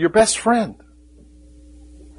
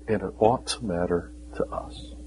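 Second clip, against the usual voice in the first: hushed, urgent whisper to the congregation; a preacher's grave whisper; 5 semitones lower, 9 dB quieter than usual. Acted. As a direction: lean in and whisper the warning. Sermon drops to whisper.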